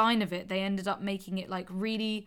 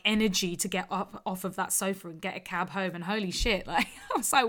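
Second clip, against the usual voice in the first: ordinary talk, nothing marked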